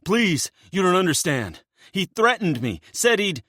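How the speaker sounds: high, frantic pleading; begging. Acted. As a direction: beg desperately to be heard. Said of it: "still begging".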